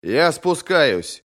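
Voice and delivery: mild shout, words stretched; calling out. A narrator's reading. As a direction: call out slowly to someone outside. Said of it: "крикнул Брент".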